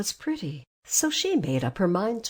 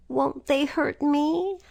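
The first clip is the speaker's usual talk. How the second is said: slow, higher worried voice; anxious girl; 7 semitones higher, 4 dB louder, slower than usual. A narrator's reading. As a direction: ask timidly. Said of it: "asked the girl anxiously".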